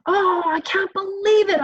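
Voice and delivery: imitating her son's intense outburst; intense upset son. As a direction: squeal out the child's outrage. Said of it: "my son is saying".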